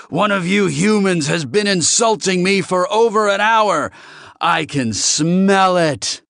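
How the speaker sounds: loud, high outraged tirade; angry accusation. Acted. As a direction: rage at the whole room, dragging out the last words. Enraged alien accuses the humans.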